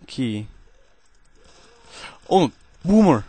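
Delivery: cheesy put-on Russian accent held through gameplay chat; deliberately hammy Russian accent, never dropping it. Gamer commentating to viewers in a cheesy Russian accent. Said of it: "trying to perform a Russian accent".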